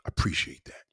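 put-on low quiet whisper to the boyfriend; a father's quiet whispered gratitude; whispered aside. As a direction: hush it like a secret thank-you. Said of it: "That man's gonna be like".

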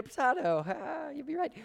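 climbing into a very high voice; playful singsong. Pretend. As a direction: toss it off in a joking falsetto. Joking that either pronunciation is right.

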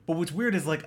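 ordinary talk, nothing marked

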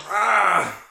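ordinary talk, nothing marked

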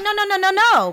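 rapid high, loud string of no's; frantic no. Retold or imitated. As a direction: protest in alarm, rising and loud. Panicked at the wait list.

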